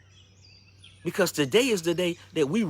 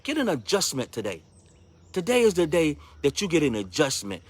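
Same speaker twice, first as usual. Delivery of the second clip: ordinary talk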